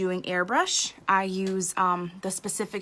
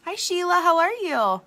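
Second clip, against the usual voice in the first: high, louder cheerful hello; cheery greeting; 10 semitones higher, 6 dB louder than usual. Real, not acted. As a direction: sing out a greeting to a friend. Greets someone off camera.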